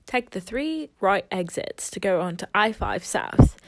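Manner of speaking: Australian satnav voice; Australian Siri. Retold or imitated. Phone assistant directing a driver onto the freeway, Australian accent. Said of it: "I have an Australian female".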